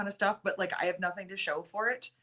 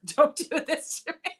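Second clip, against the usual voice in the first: laughing, higher and louder plea; laughing protest; 7 semitones higher, 3 dB louder than usual. Real, not acted. Host laughing at Lauren's teasing comment, laughing protest. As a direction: beg through laughter.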